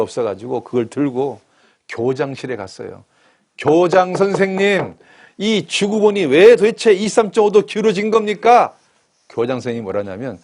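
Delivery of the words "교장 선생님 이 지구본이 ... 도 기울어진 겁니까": outraged complaint. Retold or imitated. School inspector protesting to the principal, loud outraged demand.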